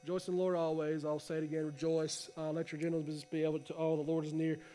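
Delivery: going through the motions, low and quiet; bored flat reading. Retold or imitated. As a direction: drone it like reading the Bible half asleep. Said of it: "how we do when we read our Bible sometimes".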